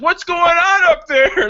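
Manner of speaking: high, loud, slow call; imitated yelling. Retelling the presenter yelling up there at the commotion, drawn-out yell. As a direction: call it up to the balcony. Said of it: "he's, like, yelling up there".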